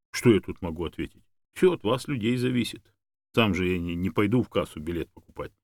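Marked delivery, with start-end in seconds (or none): none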